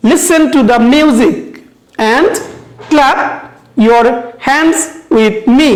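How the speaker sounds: "listen", "music", "clap", "hands", "me" stressed; stressed rhyme words. Shown as a demonstration. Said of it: "কারণ এগুলো হচ্ছে আমাদের stressed ওয়ার্ডস".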